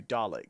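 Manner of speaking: "Dalek" ending in -ick, said low and soft; "Dalek" with a short i in the last syllable. Shown as a demonstration. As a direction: say "Dalek" as DAH-lick, rhyming with lick. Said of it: "you do hear it said like that".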